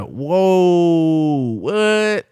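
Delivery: loud, high, drawn-out astonishment; feigned surprise. Retold or imitated. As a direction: act shocked as if you didn't know. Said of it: "we got an X surprise like we didn't know".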